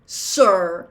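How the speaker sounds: loud, pointed "sir"; "sir" punched out after a slight break; stress on last word. A narrator's reading. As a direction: hit "sir" hard, set apart. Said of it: "emphasizing the last word".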